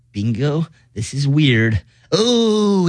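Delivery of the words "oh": ordinary talk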